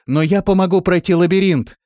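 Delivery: high, loud pleading call; shouted offer. A narrator's reading. Trapped woman offering help in exchange, shouted plea. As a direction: shout a bargain up to the rescuers. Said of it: "крикнула Биота".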